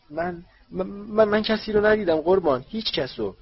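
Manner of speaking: high, loud halting house-elf voice; trembling elf. Acted. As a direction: stammer it out like a frightened elf. Winky answers terrified.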